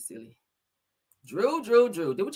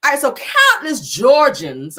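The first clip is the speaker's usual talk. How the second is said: slow emphatic; 6 semitones higher, 9 dB louder, slower than usual. Acted.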